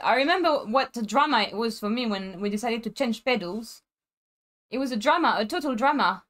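ordinary talk, nothing marked

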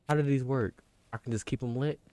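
ordinary talk

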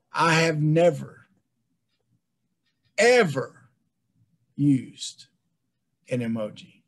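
slow and deliberate, hit "ever" hard; emphatic never. Real, not acted. Refusing emojis, emphatic.

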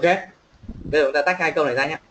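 normal talking voice

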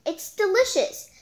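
high, loud delighted gush; delighted exclamation. Acted. Dee Dee gushing to the class about the ice cream. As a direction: gush over the taste.

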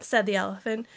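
usual speaking voice

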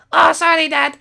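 put-on very high kid character voice; Skittles voice. Skittles explaining his crash to Dad, very high kid voice. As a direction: chirp it like an eager young son.

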